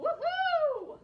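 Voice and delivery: very high, loud whoop; Lou. A narrator's reading. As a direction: whoop with sheer joy. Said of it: "cried Lou".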